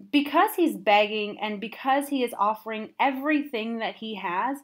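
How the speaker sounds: ordinary delivery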